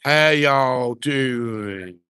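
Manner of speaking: very slow, stretched-out hello; drawn-out greeting. Real, not acted. Drawn-out greeting to arriving viewers.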